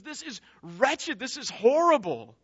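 retold cry of disgust; repulsed. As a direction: act out a king's horror, drawing out the words. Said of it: "finds this so repulsive".

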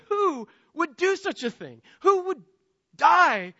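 high, incredulous cry of wonder; amazed exclamation. Acted. Preacher marvelling that God died for sinners, amazed. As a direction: ask it high, marvelling, drawing out the end. Voicing amazed response to the gospel.

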